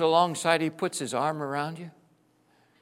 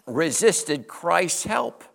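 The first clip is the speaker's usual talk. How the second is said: normal talking voice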